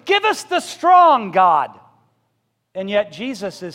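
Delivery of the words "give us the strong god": much higher and louder, slow and pleading; mock demanding prayer. Pastor mocks church begging God for strong people, loud slow plea. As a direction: cry it out like a greedy congregation.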